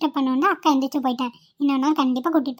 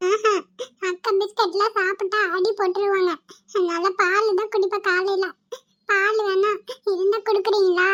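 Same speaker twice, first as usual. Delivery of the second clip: put-on higher, childlike pleading for milk; little kid's voice, higher pitched; small boy voice; 6 semitones higher, slower than usual. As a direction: play a hungry little boy asking politely. Woman voicing small hungry boy.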